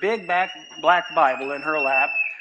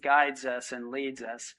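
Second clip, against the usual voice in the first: prayer; 4 dB quieter than usual. Real, not acted. Pastor closing in prayer before the sermon, quiet and earnest. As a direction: pray softly over the congregation.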